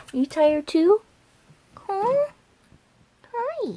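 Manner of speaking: high cooing voice for the dog. Real, not acted. Cooing high at the sleepy dog. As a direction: coo up high to coax the pet.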